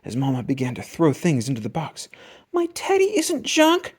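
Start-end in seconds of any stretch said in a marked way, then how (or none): 2.5-3.9 put-on much higher slow wail of a little girl; Sister screaming. A narrator's reading. Sister protesting Mama throwing away her teddy, wailing. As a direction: plead for teddy, upset. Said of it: "screamed Sister".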